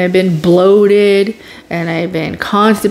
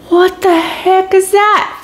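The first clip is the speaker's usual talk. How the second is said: high, bewildered; 13 semitones higher, slower than usual. Retold or imitated. Retold confusion over pain.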